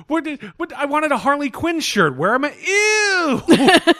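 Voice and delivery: very high, hammed-up girl voice; imagined teenage girl. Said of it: "I'm just picturing this poor girl".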